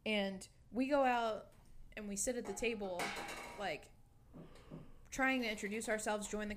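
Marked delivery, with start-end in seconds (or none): none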